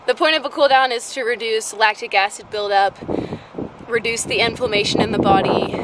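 ordinary talk, nothing marked

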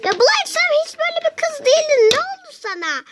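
put-on squeaky high, fast doll girl scolding Burak; a high-pitched doll pleading with her friend. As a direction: pitch way up and rush it like a toy girl. Doll quarrel, Penny's voice.

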